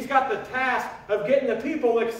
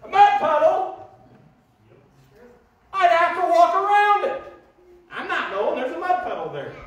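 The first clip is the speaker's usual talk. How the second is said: high, loud, whiny mock protest; mock whiny complaint; 7 semitones higher, 6 dB louder than usual. Preacher mocking complaining churchgoers, high and loud. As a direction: ham up a scandalized churchgoer.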